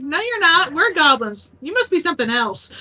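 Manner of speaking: voicing the goblins.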